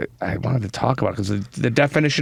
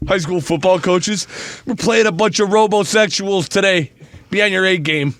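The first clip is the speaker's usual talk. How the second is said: coach pep talk; 8 semitones higher, 4 dB louder than usual. Acted. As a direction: bark it like a football coach.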